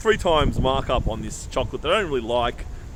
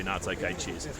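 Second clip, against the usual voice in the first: much softer and lower, mumbled politeness; lightly voiced Aussie brush-off, quiet and low; polite brush-off; 8 semitones lower, 10 dB quieter than usual. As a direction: trail off with a soft cheerio. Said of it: "And I'll say".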